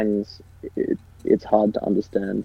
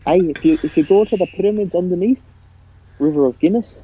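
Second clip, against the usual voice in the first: put-on Irish accent; 8 semitones higher, 4 dB louder than usual. Joins the Irish bit.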